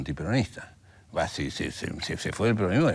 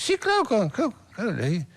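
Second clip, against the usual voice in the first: put-on high, eager, know-it-all teen; cocky teenage self; 12 semitones higher than usual. Recalling his cocky teenage self answering the German, high and smug. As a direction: toss it off like a smug kid who hasn't read it. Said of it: "mocoso, pretencioso".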